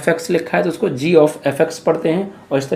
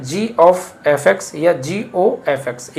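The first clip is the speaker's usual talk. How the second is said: slow spelled reading; much slower than usual. Shown as a demonstration. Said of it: "इसका pronunciation कैसे करते हैं".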